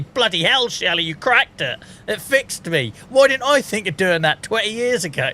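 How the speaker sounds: high, excited sarcastic astonishment; mock amazement. Streamer pretending Shelley from accounts cured him, sarcastically amazed. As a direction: act bowled over by a miracle cure.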